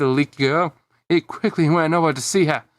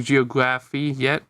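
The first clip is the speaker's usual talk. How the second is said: usual speaking voice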